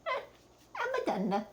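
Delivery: dismissive Italian lilt, lightly voiced; Italian accent. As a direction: shrug it off like an old Italian nonna. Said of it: "In her Italian accent".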